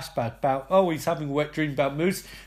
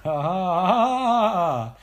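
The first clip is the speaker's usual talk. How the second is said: mock laugh; 4 dB louder, slower than usual. [name] taunting Abigail, mocking fake laugh.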